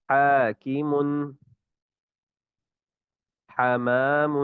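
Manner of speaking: each haa breathed out freely; correct haa. Shown as a demonstration. Teacher models the haa word list. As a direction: recite the haa words slowly and openly. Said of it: "اسی طرح یہ ہا کی بھی ادائیگی".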